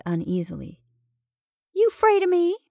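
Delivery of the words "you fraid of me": high, louder teasing question; playful teasing. A narrator's reading. Betty to the uneasy camel, high playful teasing. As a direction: pitch it right up, playfully.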